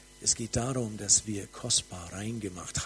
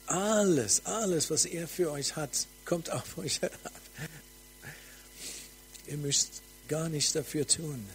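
prophetic proclamation; 5 semitones higher than usual. Acted. Preacher proclaiming glory over the congregation, ecstatic. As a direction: announce the vision as it comes down.

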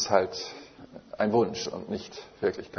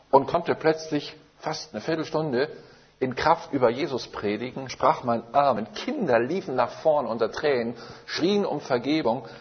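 ordinary talk

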